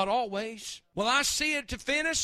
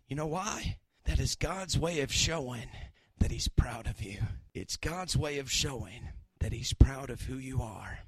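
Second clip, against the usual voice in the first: soft and tender; 11 semitones lower, 5 dB quieter than usual. Real, not acted. Intimate low sermon close.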